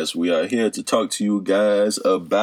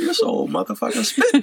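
put-on old lady voice. Voicing old lady Ethel.

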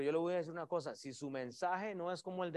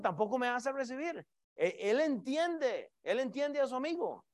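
preaching at full force; 6 semitones higher, 7 dB louder than usual. Acted.

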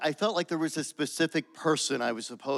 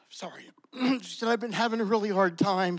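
real tears catching the words, barely holding it together; fighting back crying mid-story; voice breaking; 6 semitones higher than usual. Real, not acted. Retelling the woman's hardship, choked up and tearful.

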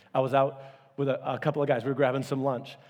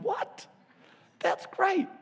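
much higher, surprised what then pleased; surprised delight; much slower than usual. Retold or imitated. Pastor hearing a visitor's answer, high surprised. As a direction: pop up high in happy surprise. Reacting to hearing Brian's name.

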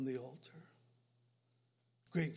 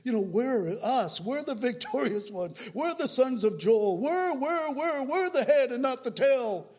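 higher, louder mock boast; mocking triumphalism; 5 semitones higher, 6 dB louder than usual. Preacher mocking a church's victorious chanting. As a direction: ham up the bragging church crowd. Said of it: "And you wondered".

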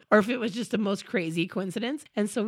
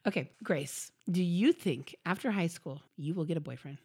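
mock serious interviewer; 4 semitones lower, 6 dB quieter than usual. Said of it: "She's sitting there like a judge".

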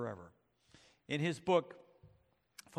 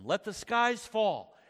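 slow, higher, louder shriek; 6 semitones higher, 5 dB louder, slower than usual. Acted. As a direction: howl it out in defiance. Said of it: "shrieked Rabadash".

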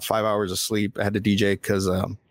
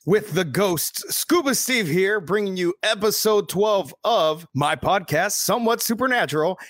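podcast host intro; 7 semitones higher than usual. Acted. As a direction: hype up the show like a host. Host opens podcast with music.

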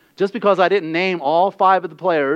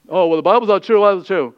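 louder, lightly mimicked scoffer; mock skeptic; 5 dB louder, faster than usual. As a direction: imitate a doubter objecting. Mimicking doubters of the Bible.

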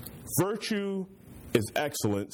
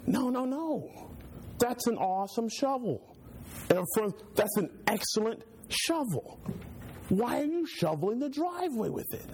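much higher, protesting his prized shovel; alarmed pastor; 9 semitones higher than usual. Retold or imitated. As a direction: squeal in horror at the misuse. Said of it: "And I said".

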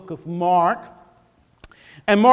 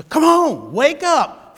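urging call; 7 semitones higher, 4 dB louder, slower than usual. Retold or imitated. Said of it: "he's calling us to".

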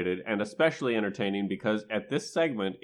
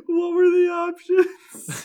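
high squeaky laughing question; 8 dB louder, much slower than usual. Real, not acted. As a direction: ask it while cracking up. Cracking up after mishearing.

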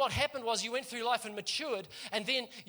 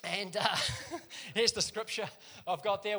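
ordinary talk

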